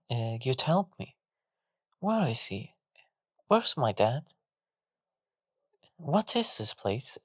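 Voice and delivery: put-on a scared child asking questions, stumbling; soft, frightened girl. A narrator's reading. Lost daughter asking the golem where her dad is, soft and frightened. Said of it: "in a soft yet frightened voice".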